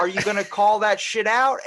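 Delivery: calling it out. Retold or imitated. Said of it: "And it's like".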